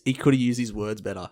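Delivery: ordinary talk, nothing marked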